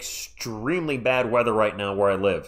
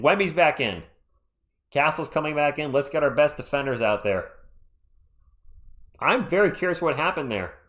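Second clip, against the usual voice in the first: ordinary talk, nothing marked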